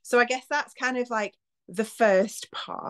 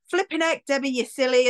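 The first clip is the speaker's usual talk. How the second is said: much higher exasperated mock scold; self-scolding; 8 semitones higher than usual. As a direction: tut at yourself after a fumble. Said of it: "I've dropped something and I'm like".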